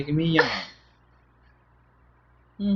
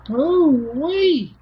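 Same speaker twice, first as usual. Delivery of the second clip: excited; 14 semitones higher, 4 dB louder than usual. Real, not acted. Excited at baby.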